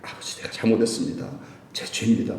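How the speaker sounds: whispered repentant prayer; praying quietly to the Father in confession; hushed confession. Pretend. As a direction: confess it softly under your breath. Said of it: "내가 그걸".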